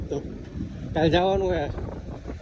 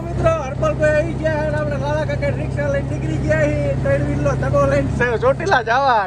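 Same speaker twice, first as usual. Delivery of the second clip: raised, lively travel-show patter; hyped vlog narration; 8 semitones higher than usual. Acted. Hyping the rickshaw trip to viewers, lively vlog patter. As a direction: sell the rickshaw ride to the viewers.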